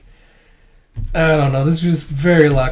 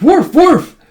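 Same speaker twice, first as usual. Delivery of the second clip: high, loud spoken woofs; dog bark; 13 semitones higher, 7 dB louder than usual. Retold or imitated. Imitating the dog barking.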